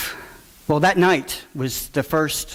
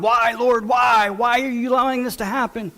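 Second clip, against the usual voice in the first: yelling at God; 8 semitones higher than usual. Retold or imitated. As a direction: cry out to God in despair, pitch way up. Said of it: "he's yelling at God".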